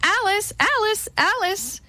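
high-pitched mother's call. A narrator's reading. Old woman calls Alice like her mother, high calling. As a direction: call the name high, for help. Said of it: "Suddenly she called".

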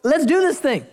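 high and eager, come on let's go; eager invitation. Retold or imitated. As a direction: call him out of the boat with excitement. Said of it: "Jesus says".

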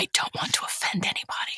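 hushed, voiceless whisper; apologetic whisper. Pretend. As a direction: whisper so as not to be overheard. Keeps whispering her cat confession.

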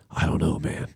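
low, soft, doubtful reply; retold friend's subdued uncertainty; unsure mumble. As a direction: answer softly, pitched well down. Friend's doubtful answer in retelling.

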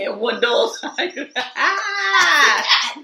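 real laughter bursting out; laughing tease. Real, not acted. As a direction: crack up on the punchline. Teasing co-host about tires, cracking up.

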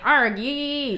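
high pirate growl, lightly done. Retold or imitated. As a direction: ham up a pirate. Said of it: "I'm reading it in a pirate voice".

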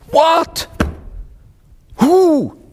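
high, loud startled cry; shocked outburst. Retold or imitated. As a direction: blurt it out in disbelief. King's shocked outburst retold.

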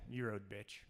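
a low mock biker sneer; mock tough biker. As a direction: growl it like a tough guy.

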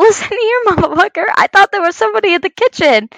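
high-pitched mock telling-off, broken by a laugh; acted angry comeback. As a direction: re-enact scolding your partner, half laughing. Her side of retold quarrel.

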